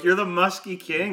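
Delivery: high, loud retold disbelief; incredulous exclamation. Storyteller saying it out loud to the man, incredulous. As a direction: throw the title back in amazement. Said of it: "I say this out loud to him".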